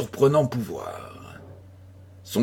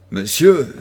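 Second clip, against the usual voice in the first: curt, louder snap of a single word; rough tone; 7 dB louder than usual. A narrator's reading. Sick philosopher rebuffing the commissioner, curt and loud. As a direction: cut him off gruffly. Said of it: "dit le philosophe d'un ton rude".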